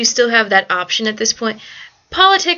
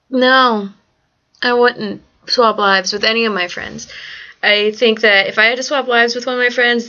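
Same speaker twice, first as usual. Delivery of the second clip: ordinary delivery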